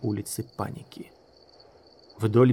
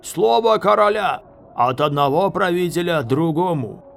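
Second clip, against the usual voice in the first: loud, high proclamation; shouted pledge; 6 semitones higher, 6 dB louder than usual. A narrator's reading. Enemy officer pledging the king's word, shouting. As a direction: proclaim it through the door.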